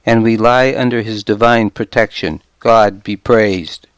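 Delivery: ordinary delivery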